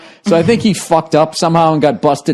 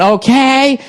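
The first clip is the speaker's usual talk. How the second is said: fussy lady; 9 semitones higher than usual. Retold or imitated. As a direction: imitate a lady fussing over the hurt man. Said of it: "she kept, kept going".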